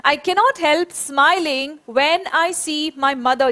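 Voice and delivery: very slow, each word spaced for the class; slow dictation. Shown as a demonstration. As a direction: say it slowly so children can repeat. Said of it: "ये पूरा structure साथ में बोलेंगे". (बहुत धीरे, शब्द-शब्द अलग करके बोलना)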